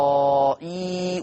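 إسرائيل with the aa held long, as in recitation; prolonged madd. Shown as a demonstration. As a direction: chant إسرائيل, stretching the vowel before the hamza. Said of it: "ويمد أربعة ويمد ستة". (إسرائيل بمدّ طويل كالتلاوة)

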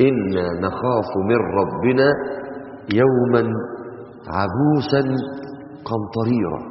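slow, low melodic recitation; measured tajweed reading; Quran recitation. Acted. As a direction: recite it slowly as from the mushaf.

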